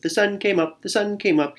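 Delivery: high, light chirpy squirrel voice; chirping squirrel. Acted. Squirrel gloating at the sunrise, high chirpy voice. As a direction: chirp it like a happy little squirrel. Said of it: "he chirped".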